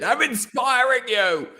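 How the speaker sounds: very high, drawn-out comic insistence; joking, high-pitched rallying cry; mock inspirational yell. Echoes the joke about yelling motivation.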